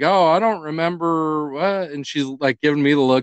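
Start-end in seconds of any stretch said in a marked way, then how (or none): 0.0-1.9 slow, higher put-on voice of a girl playing dumb; imitating his daughter. Dad voicing his older daughter dodging blame, slow and higher. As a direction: drag it out like a kid covering up. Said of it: "And Kayla's like".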